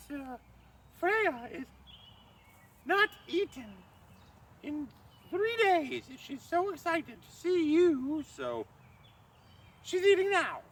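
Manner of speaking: high simpering maid's voice; falsetto maid. Acted. Loki in disguise presents the bride to Thrym in falsetto. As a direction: keep the high fawning disguise voice.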